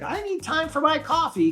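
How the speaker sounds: high-pitched, put-on wife voice; imitating wife. Retelling wife demanding coffee time at the airport, high mimicking voice. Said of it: "She's like".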